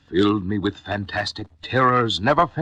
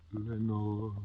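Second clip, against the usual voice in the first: soft, far-off echo of the name; echoing murmur; 11 dB quieter than usual. A narrator's reading. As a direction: let it come back like an echo. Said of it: "an echo murmured back the word".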